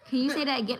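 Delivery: ordinary talk, nothing marked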